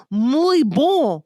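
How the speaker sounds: husband's broken Spanish, hammed up; mock bad Spanish. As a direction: ham up a clumsy greeting.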